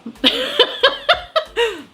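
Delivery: a long high laugh; high breathy laugh. Real, not acted. Reviewer laughs at her own joke, a long high laugh.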